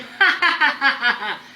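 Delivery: put-on high, loud childish giggle; child's laugh. Children in the crowd laughing at the emperor. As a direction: burst out laughing at him. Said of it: "they began to laugh".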